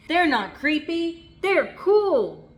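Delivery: put-on high, loud protesting child; insistent kid. A narrator's reading. Jasper arguing with Mom about underwear. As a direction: insist like a kid who's sure. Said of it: "said Jasper".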